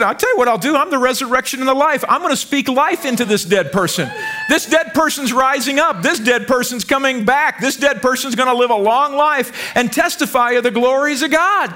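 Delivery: preaching shout. Acted. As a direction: declare it with full authority. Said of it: "And he said".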